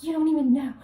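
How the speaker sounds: hammed-up indignant outburst; retold indignation. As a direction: burst out in mock fury. Acts her furious thought again.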